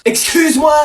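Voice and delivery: high loud offended cry; scandalised retort; mock outrage; indignant excuse me. Outraged at carapuce.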